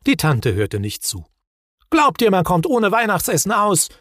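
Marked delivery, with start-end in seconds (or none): 1.9-3.9 louder, higher impatient retort; aunt annoyed by the question. A narrator's reading. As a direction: snap it impatiently. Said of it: "sagte sie ungeduldig".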